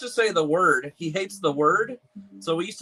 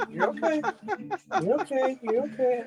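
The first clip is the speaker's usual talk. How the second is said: soothing the puppy; 10 semitones higher, 3 dB quieter than usual. Real, not acted.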